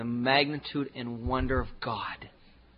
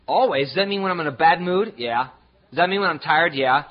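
ordinary delivery

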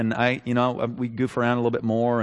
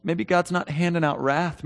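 ordinary talk, nothing marked

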